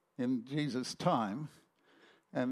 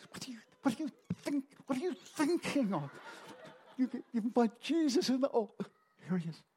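high, fast, laughing flustered housewife; a panicked wife hissing at her husband, then sweetening up; 7 semitones higher than usual. Retold or imitated. Wife flustered at unexpected guests, high laughing voice. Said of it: "Poor Missus Zach".

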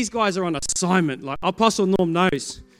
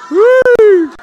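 high loud whoop; excited whoop; 14 dB louder than usual. Real, not acted. Preacher whooping at the crowd, loud and high. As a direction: let out a big high whoop. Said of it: "reacting to the leader's reply".

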